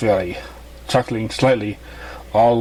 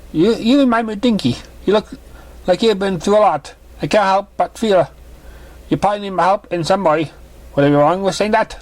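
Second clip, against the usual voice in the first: higher, gentle mother's voice; motherly mare voice; 8 semitones higher than usual. Acted. Mother greeting her daughter's new guest, higher voice. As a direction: play the kind mother pony.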